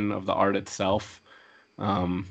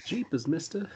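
put-on wholesome 1950s kid; 7 semitones higher, 8 dB quieter than usual. Wholesome exclamation after Jesus.

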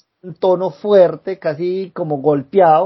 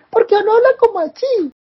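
much higher, squeaky mock voice. Teasing co-host about her childlike voice, squeaky high mock. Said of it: "por los hombres es más bacano".

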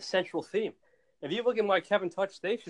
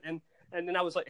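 normal talking voice

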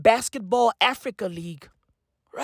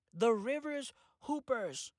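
much softer, at normal pitch; deadpan repeat; 14 dB quieter than usual. Real, not acted. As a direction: drop it quietly, with a pause between the words. Deadpan repeat after the outburst.

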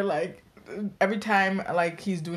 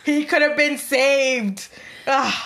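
mock outrage; 5 semitones higher, 5 dB louder, slower than usual. Real, not acted. Mock outrage over Titanic door.

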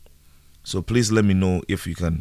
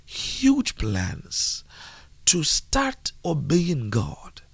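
slow, high-pitched emphatic preaching; 10 semitones higher, slower than usual. Acted. Preacher urging listeners to obey God, preaching. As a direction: preach it slowly, stretching the key words.

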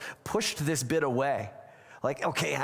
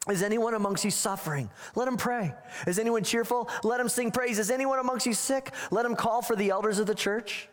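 pitched higher, reading scripture out to the congregation; 9 semitones higher than usual. Acted. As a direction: proclaim the verses, lifted and ringing. Reads James aloud in sermon.

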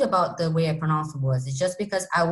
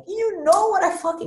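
high-pitched exasperated retort; mock indignant comeback; retold exasperation; 10 semitones higher than usual. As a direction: act out being fed up. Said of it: "make me really upset".

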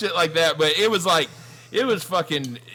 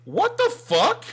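high, stunned what the fuck; startled stranger finding a man in her car; shocked woman; 4 dB louder, slower than usual. Retold or imitated. Woman in the front seat, shocked at a stranger, high and stunned. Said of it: "And she goes".

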